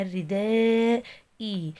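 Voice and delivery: "الرداء اي" drawn out slowly into a long ee; lengthened final vowel. Shown as a demonstration. Teacher sounding out verse for students, "الرداء اي" with final kasra stretched. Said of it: "اشباع بالكسر، فولد لي ياء ساكنة".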